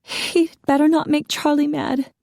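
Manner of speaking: high, anxious warning; agitated wife warning the officer. A narrator's reading. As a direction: worry mounting with each word. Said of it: "growing more agitated".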